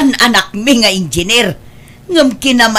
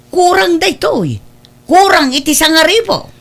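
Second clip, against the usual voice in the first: surprised; 9 semitones higher than usual. Acted. Mother counts short salary.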